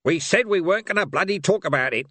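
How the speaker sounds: irritable. A narrator's reading. Gruff barbarian snapping.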